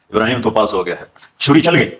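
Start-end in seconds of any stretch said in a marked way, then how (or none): 1.4-1.9 emphatic exclamation. Acted. Climax of the sacrifice story.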